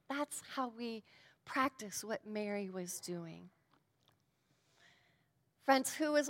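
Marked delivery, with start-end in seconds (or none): 0.1-3.5 whisper. Acted. Preacher drops into a whisper.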